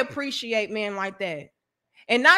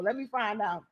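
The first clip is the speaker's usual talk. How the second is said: ordinary talk, nothing marked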